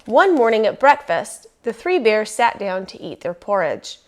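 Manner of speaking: ordinary delivery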